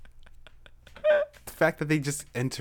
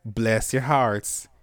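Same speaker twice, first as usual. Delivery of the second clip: slow, lightly put-on Southern; Southern accent; slower than usual. Blessing the followers in a slow mock Southern accent. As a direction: stretch it like a Southern aunt. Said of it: "let's do it in a country accent".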